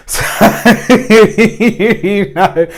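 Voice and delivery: high, loud burst of laughter; laughing. Real, not acted. Amused at guys babying their cars, laughing. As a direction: laugh right through the words.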